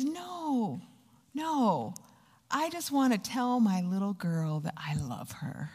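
soft, gentle reassurance; retold tender father. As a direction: reassure your daughter quietly and lovingly. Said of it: "And he says".

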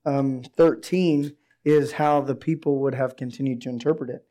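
ordinary talk

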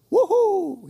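high, loud cheer; acted burst of excitement; gleeful whoop. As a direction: whoop as if leaping overboard for joy. Peter leaping out of the boat.

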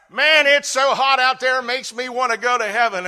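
high, loud cheerful call; retold loud remark. Pastor telling the baker it makes him want heaven, loud. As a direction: announce it loudly to the room. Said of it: "and I said".

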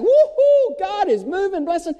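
high, loud joyful whoop; woo-hoo thrown up high and loud; excited whoop. Real, not acted. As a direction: cheer it like a revival shout. Excited preacher exclaiming joy.

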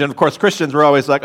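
ordinary talk, nothing marked

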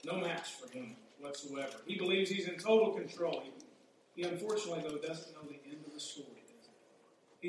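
ordinary delivery

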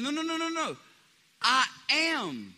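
high, emphatic no's; Jesus cutting off Martha, high and emphatic; Jesus correcting. Retold or imitated. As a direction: stop her short, then declare.

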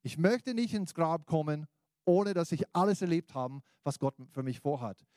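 loud, high preacher's call; rousing sermon voice; preaching. Acted. Preaching appeal.

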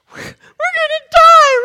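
high, loud hammed-up terror; panicked cry. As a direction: cry out as if about to crash. Retold panic before imagined crash.

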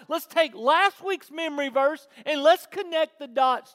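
high, loud, emphatic preaching. Real, not acted. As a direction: press the question hard on the congregation. Preaches at full force.

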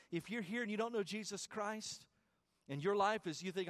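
normal talking voice

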